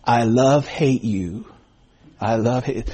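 low, slow imitation of a smiling joker; half-attempted comic accent with a smile; [name]'s funny voice. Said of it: "look at them and smile and say".

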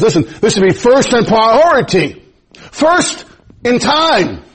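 high, slow emphasis on First in time; preaching, slowing down. Acted. Pastor drives home priority to congregation, slow and high.

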